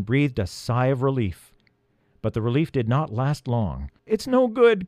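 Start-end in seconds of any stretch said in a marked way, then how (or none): 4.1-4.9 high, desperate wail in words; cry of despair. A narrator's reading. Elf failing to hold the rope, despairing cry. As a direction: cry out as hope slips away. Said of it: "cried Leolin in despair".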